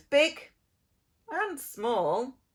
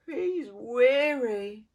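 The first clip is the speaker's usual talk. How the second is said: ordinary delivery, nothing marked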